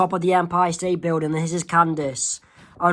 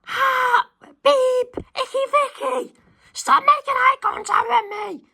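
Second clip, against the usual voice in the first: yelling out. Acted. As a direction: bellow it at her from across the canteen, voice jacked way up. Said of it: "somebody yells out".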